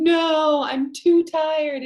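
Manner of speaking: high, loud, slow whine; sleepy Toad. Retold or imitated. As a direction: whine like a child who won't get up. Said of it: "Toad kept saying".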